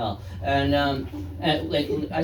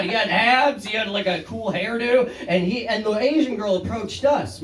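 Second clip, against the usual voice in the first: ordinary talk, nothing marked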